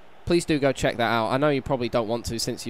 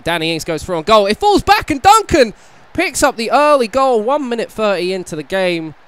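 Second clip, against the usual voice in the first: sudden high, loud goal call; excited commentary; 7 semitones higher, 8 dB louder than usual. Real, not acted. Early goal in the match.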